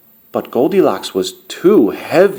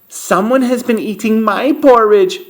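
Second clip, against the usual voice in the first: lightly voiced mother bear, raised in pitch; middle-sized bear voice, higher; Mama Bear; 7 semitones higher than usual. A narrator's reading. Mama Bear finds her porridge was eaten, middle-sized voice. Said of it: "said Mama Bear in her middle-sized voice".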